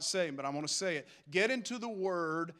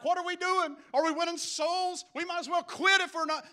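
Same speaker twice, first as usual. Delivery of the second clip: high, urgent challenge; rallying the troops with raised pitch; fired-up exhortation; 9 semitones higher, 4 dB louder than usual. Retold or imitated. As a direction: imitate being all worked up, pitch jumping well up. Acting out fired-up reaction to friend.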